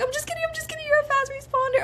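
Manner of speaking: lightly voiced prankster mom; teasing mom. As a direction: mimic her fake-out in a high voice. Imitating mom taking back news.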